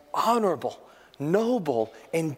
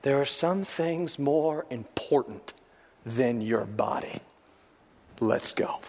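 quiet resolve; 4 semitones lower, 4 dB quieter, slower than usual. Retold or imitated. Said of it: "what he said afterward".

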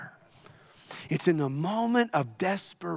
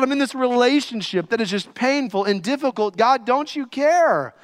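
aggrieved, higher and louder plea; anguished protest to God; 7 semitones higher, 4 dB louder than usual. Acted. Sufferer questioning God in pain, anguished protest. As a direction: cry out in protest, pitched up. Preacher voices a sufferer's complaint.

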